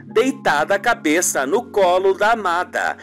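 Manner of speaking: ordinary talk, nothing marked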